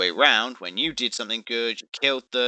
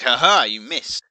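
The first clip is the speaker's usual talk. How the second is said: mocking taunt; 3 semitones higher, 7 dB louder, slower than usual. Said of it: "you could be like".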